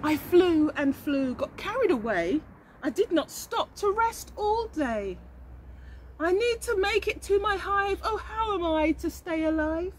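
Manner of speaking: high, weepy little bee voice; a worn-out bee whimpering her story; tired bumblebee. Acted. The bee worries about home.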